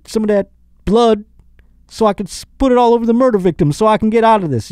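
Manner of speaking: high, loud mock O J voice calling his buddy; O J on the phone. O J calls Al Cowlings for help, high mock voice. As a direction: ham up a scheming phone call. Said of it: "wouldn't he go".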